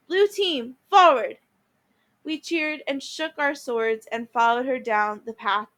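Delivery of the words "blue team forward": loud, higher rallying yell; yelled command. Acted. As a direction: call the team forward. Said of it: "Annabeth yelled".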